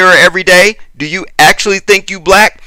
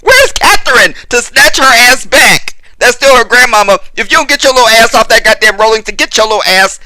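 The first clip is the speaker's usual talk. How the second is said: higher-pitched, exasperated telling-off; mock scolding; 6 semitones higher than usual. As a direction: scold like a fed-up elder, half in jest. Mock scolding of someone else's child.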